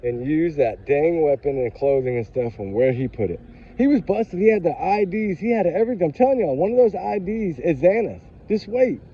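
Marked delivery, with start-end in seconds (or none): 3.8-9.0 higher, eager telling of the news; excited gossip. Real, not acted. Telling her viewers what she heard, excited. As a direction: spill the secret like it's hot news. Spilling insider news about suspect.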